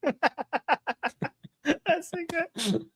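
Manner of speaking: high, soft voice through real giggles; tickled, words dissolving into laughter; laughing. Real, not acted. Still laughing at the accent attempt.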